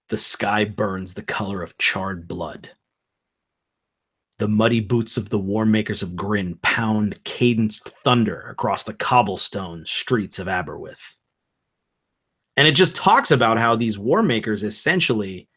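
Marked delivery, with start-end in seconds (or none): 0.1-11.1 dramatic story narration. Acted. Reads the book's opening narrative.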